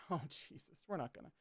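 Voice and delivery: very soft, under the voice; quiet, sinking exclamation; muttered aside. Acted. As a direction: mutter it in hushed dismay. Acted muttered aside of dread.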